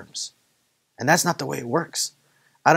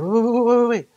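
high, loud string of urgent waits; alarmed protest; 12 semitones higher, 5 dB louder than usual. Retold or imitated. Intern stopping the professor as he leaves, alarmed protest. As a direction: call out in a panic to stop him.

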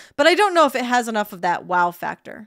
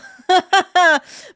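laughing; 9 semitones higher, 8 dB louder than usual. Real, not acted.

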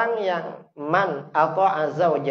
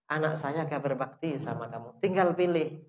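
ordinary delivery

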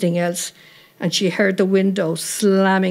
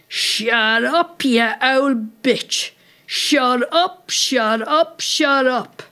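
higher-pitched, repeated irate command; angry yelling; 5 semitones higher, much slower than usual. A narrator's reading. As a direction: yell down at her in irritation. Man yelling abuse from window.

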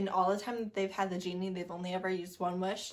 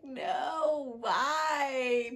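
mock whine; 3 semitones higher than usual. Reacts to a bad review.

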